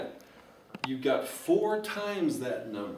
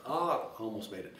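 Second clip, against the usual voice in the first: usual speaking voice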